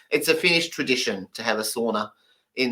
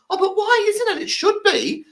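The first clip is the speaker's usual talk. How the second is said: much higher, whiny protest; mock indignant learner. Learner objects to the deaf teacher, high whiny mock protest. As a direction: squeak it out like a baffled student. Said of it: "And they go".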